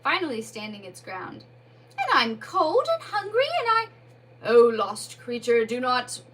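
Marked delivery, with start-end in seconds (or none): 2.0-3.9 Mole insists on who he is, put out; irritable. Acted. Mole complains. 4.4-6.2 loud, slow, grave pity; solemn Badger voice. Badger addresses ghost.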